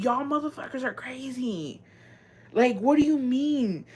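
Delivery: mock appalled clapback to commenters; incredulous outrage. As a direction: scoff at them in disbelief.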